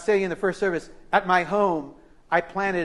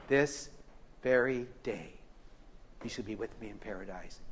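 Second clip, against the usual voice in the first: soft promise; 7 semitones lower, 12 dB quieter than usual. Retold or imitated. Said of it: "And Jesus said".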